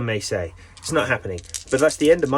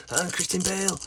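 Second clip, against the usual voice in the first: a hint of a gruff movie-star voice, quieter and higher; lightly voiced film star, not very good; 7 semitones higher, 5 dB quieter than usual.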